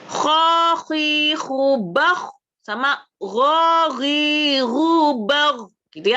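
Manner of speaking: rasping "Kha" then gargled "gha"; teacher contrasting kha and ghain; kha and ghain drill. Shown as a demonstration. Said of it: "Ada kha sama ada ghain".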